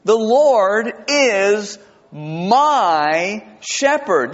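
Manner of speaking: very slow, drawn-out, reverent reading; reverent recitation. Shown as a demonstration. Pastor reciting Psalm twenty-three slowly to the congregation. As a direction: savour each word of the verse. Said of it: "You know how it starts".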